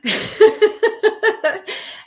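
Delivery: a real burst of laughter; bright laugh. Real, not acted. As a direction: laugh out loud, high. Amused at picking a number.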